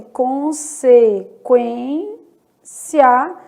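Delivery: "consequência" split slowly, u still sounded; slow, spaced syllables on "consequência", kw sound kept. Shown as a demonstration. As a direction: sound out "consequência" as con, se, kwên, cia. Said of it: "O som não mudou".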